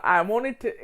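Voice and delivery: ordinary talk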